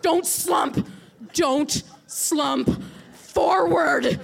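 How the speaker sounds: loud, slow commands to herself like scolding a dog; inner yelling. Retold or imitated. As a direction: shout no no no at yourself, stretching the words. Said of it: "I start yelling in my head".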